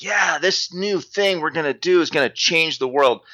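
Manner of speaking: high, eager hype; really excited. Retold or imitated. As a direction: gush like it's a brand-new dream project. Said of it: "you get really excited".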